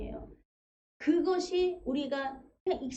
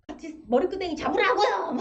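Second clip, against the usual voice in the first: higher, louder mock egging-on; 6 semitones higher, 5 dB louder than usual. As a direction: urge someone to fight back, half joking. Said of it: "누가 나한테 막 뭐라 그래?". (부추기는 흥분된 말투)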